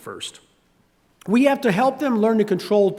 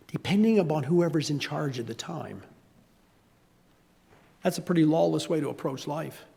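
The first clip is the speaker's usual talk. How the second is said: ordinary talk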